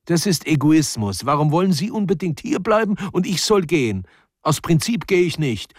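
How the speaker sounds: high-pitched indignant protest; indignant refusal. Acted. Student refusing to give up the arbour, indignant. Mitja refuses in an acted quarrel.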